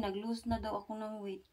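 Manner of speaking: ordinary talk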